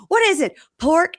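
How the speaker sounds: high-pitched mock horror; horrified. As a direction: squeak it in exaggerated disbelief. Re-enacting his horrified question.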